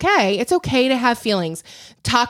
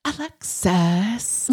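name dragged out very slowly; affected, drawn-out sitcom accent; Schitt's Creek impression; 3 dB quieter, much slower than usual. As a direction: stretch the name in a put-on character voice. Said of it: "I can do Schitt's Creek accents".

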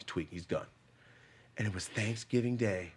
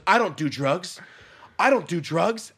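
mock denial; 8 semitones higher, 9 dB louder, slower than usual. Imitating woman denying.

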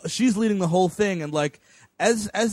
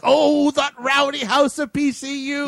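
higher, slow, hammed-up outrage; mock steamed; 8 semitones higher, 4 dB louder, slower than usual. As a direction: imitate an official getting all steamed. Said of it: "she's just getting all steamed".

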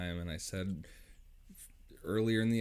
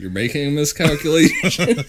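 high, loud laughing warning; hammed-up official caution; mock-serious warning; 8 semitones higher, 12 dB louder than usual.

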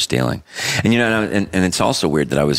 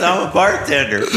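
judge's incredulous echo, pitched way up; high mocking repeat; 13 semitones higher than usual. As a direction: repeat it back in disbelief, high. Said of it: "He goes".

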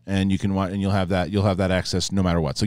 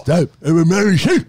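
high, loud put-on voice of a whiny heroine; mock heroine voice; 8 semitones higher, 10 dB louder than usual. Mocking the trailer's heroine to friends, loud high put-on voice. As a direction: send up the heroine as a Mary Sue.

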